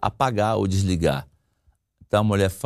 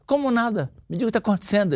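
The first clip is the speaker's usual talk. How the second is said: higher-pitched mock exasperation; irritated wife; 9 semitones higher, faster than usual. Wife demands what is happening, mock irritated. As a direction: press him like a mildly irritated wife. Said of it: "a esposa se irrita".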